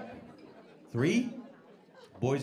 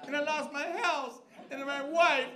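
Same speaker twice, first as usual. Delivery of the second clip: mock lament; 13 semitones higher than usual. Sad list, mock lament.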